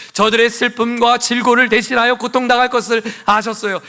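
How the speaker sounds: high, loud impassioned sermon; ringing, high-pitched pulpit proclamation; full-force preaching. Real, not acted. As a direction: preach it out to the whole congregation.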